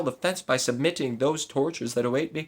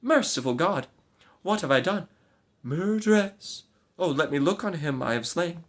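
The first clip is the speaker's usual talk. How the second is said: anguished lament; 8 semitones higher than usual. Acted. Maid realizes her crime.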